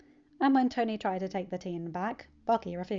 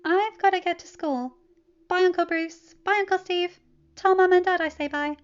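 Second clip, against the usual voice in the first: put-on high, louder, bright-eyed teen voice; cheerful teen; 10 semitones higher, 9 dB louder than usual. Teen telling his uncle about his weekend, cheerful and high. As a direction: bounce through it like an eager kid.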